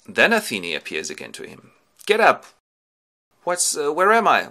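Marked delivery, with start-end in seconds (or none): 2.0-2.4 loud, high sudden command; wake-up shout. Acted. Athene waking Ulysses on the beach, sharp shout. Goddess wakes sleeping Ulysses. 3.4-4.5 loud, high, confused question; startled waking. Acted. Ulysses woken on the beach, startled confusion.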